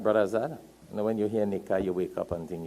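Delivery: usual speaking voice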